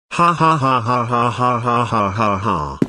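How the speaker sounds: robotic spelt-out gloating laugh; mechanical, evenly repeated villain laugh; mock evil laugh. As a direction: read out a string of stiff ha-has. Gloating over planned voice swap.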